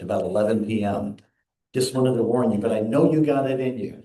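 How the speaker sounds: ordinary talk, nothing marked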